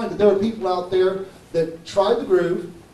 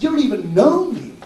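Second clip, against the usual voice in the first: high, louder note of disbelief; astonished; 6 semitones higher, 4 dB louder than usual. Retold or imitated. Customer to the repairman who owes him nothing, astonished. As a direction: imitate someone amazed by a stranger's kindness.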